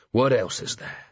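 understated growling question; near growl. A narrator's reading. Vimes presses his captain for answers, nearly growling. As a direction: growl it a little, holding it back. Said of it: "Vimes was nearly growling".

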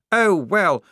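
high, loud, flustered officer voice; nervous. A narrator's reading. Lieutenant admitting the lack of training, nervously jolly. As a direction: bluster through it with nervous cheer. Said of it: "said the lieutenant nervously".

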